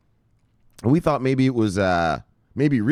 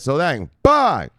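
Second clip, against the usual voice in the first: slow, loud, drawn-out put-on bye; cartoonish drawn-out farewell; sing-song bye; 5 semitones higher, 7 dB louder, much slower than usual. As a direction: stretch the goodbye in a silly voice. Sing-song sign-off of segment.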